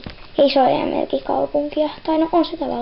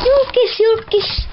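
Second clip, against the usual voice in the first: excited calling; 7 semitones higher, slower than usual. Acted. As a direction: call out to the crowd. Calling out the celebrity. (innoissaan huutaen)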